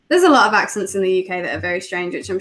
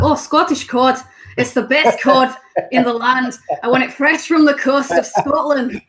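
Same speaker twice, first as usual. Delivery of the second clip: put-on a light Scottish accent, a bit higher and louder; 4 semitones higher, 3 dB louder than usual. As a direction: sell the cod like a Scottish fishmonger. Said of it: "So I'd be like".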